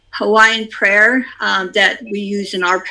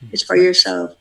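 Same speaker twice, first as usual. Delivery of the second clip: usual speaking voice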